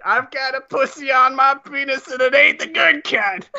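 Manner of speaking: high, put-on caller voice pitched well up; mock woman voice, high and prim. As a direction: play a prissy lady caller reporting her trouble. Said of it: "the voice you did that in".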